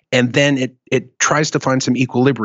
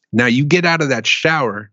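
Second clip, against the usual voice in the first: normal talking voice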